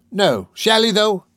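high, loud, delighted disbelief; eager cry. A narrator's reading. Charlie hearing of his friend's comforts, eager. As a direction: cry out, thrilled by the promise. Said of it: "cried Charlie Bates".

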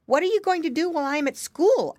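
quick, high little pig; piggy boy voice. A narrator's reading. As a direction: ask brightly like a young piglet. Curly asks his brother.

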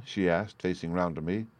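plain reading voice

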